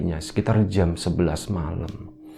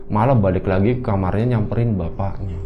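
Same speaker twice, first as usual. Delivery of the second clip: normal talking voice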